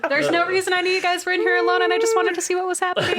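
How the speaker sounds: high, fast, loud, through laughter; laughing excuse. Acted. Player voices the intruder's flimsy excuse, laughing and rushed. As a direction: rattle off a silly excuse while laughing. Joining the eavesdropper joke, laughing.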